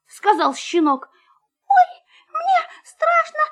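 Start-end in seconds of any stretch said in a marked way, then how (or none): 1.7-3.4 very high frightened squeal; high whimpering mouse voice; scared squeaking mouse. A narrator's reading. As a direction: squeak in fear like a tiny mouse. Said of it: "запищал мышонок".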